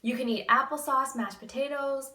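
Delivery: ordinary talk, nothing marked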